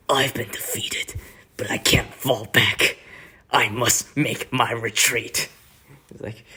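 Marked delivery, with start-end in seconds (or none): 0.1-5.5 breathy defeated villain, drawn out; half-whispered, grave villain retreat speech; dramatic, slow concession of defeat; Hubert impression. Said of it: "he says".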